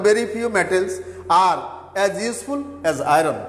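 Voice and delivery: slow, careful reading of the example; measured, slowed-down example. Shown as a demonstration. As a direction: spell the sentence out slowly for students. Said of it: "যেমন".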